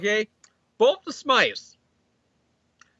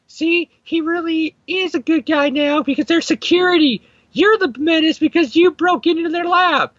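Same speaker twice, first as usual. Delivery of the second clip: imitating Gwen clone; 9 semitones higher than usual. Gwen clone telling Peter he is the menace, smug put-on voice. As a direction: play her as a smug, lecturing Gwen. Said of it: "the Gwen clone is like".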